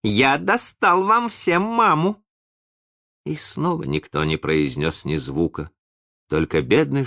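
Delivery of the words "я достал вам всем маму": high, stretched-out proud news; slow, high, delighted announcement; cheerful. A narrator's reading. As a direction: present the surprise gleefully. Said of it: "весело сказал он".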